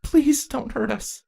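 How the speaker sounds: high, slow, tearful plea; sniffled. Acted. Saronis begs the ponies not to hurt them, sniffling. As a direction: beg through sniffles. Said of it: "Serona sniffled".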